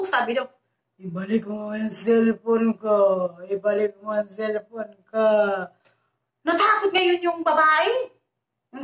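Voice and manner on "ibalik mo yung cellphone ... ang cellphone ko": low, slow, eerie ghost voice on the phone line; ghostly voice. Retold or imitated. Ghost on the phone demanding the cellphone back, low eerie moan. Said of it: "Parang nagmumulto".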